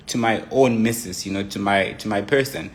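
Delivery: usual speaking voice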